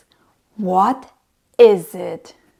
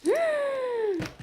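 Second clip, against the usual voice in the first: big, high, breathy gasp of surprise; surprised gasp; 14 semitones higher, 11 dB quieter than usual. Acted. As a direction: gasp like seeing a treat. Reveal after countdown.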